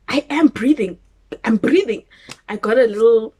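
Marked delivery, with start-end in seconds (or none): 0.1-2.0 irritated insisting. Retold or imitated. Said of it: "I was like".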